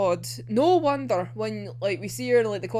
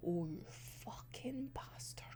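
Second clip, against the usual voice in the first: slow, unvoiced whispered insult; whispered curse; 4 semitones lower, 17 dB quieter, slower than usual. Cursing Mori to herself while reading, whispered. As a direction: breathe the curse out slowly under your breath. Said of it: "And I was like".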